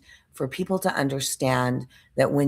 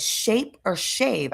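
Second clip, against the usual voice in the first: drawn out slowly and higher, puzzled; slow confused question; 7 semitones higher, much slower than usual. Retold or imitated. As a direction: spell it out slowly, trying to understand. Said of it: "because I was so confused".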